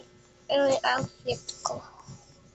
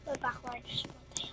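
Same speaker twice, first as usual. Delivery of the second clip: soft secret whisper; 16 dB quieter than usual. Real, not acted. Girl whispering a plan to her viewers. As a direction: lean in and whisper it.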